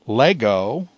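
Lego with LE stressed; stress on penult. Shown as a demonstration. Teacher showing the acute's place, Lego stressed on LE. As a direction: lean on the first syllable of "Lego". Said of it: "acute is found on the penult".

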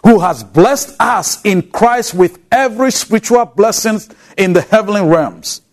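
ordinary delivery

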